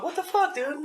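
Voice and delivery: tiny, high, soft squeak; baby's voice. Retold or imitated. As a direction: voice a baffled baby, very high and quiet. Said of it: "the baby looks up like".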